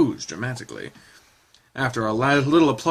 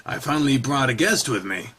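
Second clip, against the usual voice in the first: ordinary talk, nothing marked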